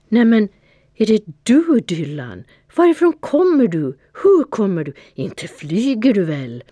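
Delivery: high, loud, breathless surprise, racing on "Varifrån"; stammering astonishment. A narrator's reading. Fia sees Dylan arrive flying, high astonished stammer. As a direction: gasp out the questions in disbelief. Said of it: "Hon stammade av förvåning".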